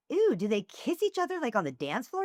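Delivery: high-pitched feigned squeamish question; mock shocked curiosity. Hostess feigning ignorance to disgusted girl, mock shocked curiosity. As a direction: play innocent and scandalized, starting high. Playing shocked innocent in retold exchange.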